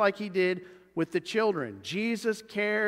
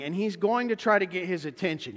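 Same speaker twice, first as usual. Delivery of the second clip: normal talking voice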